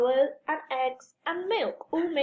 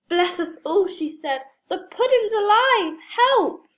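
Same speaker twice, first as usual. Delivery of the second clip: loud, high alarmed cry; frightened shout; 6 semitones higher, 7 dB louder than usual. A narrator's reading. Mother shrieking as her pudding comes alive. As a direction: cry out in fright, louder and higher.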